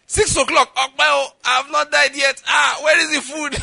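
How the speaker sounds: high, loud cry for food; hammed-up, desperate shout at breaking the fast; hungry outcry. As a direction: burst out as if rescued from starvation. Acts starving man at fast's end.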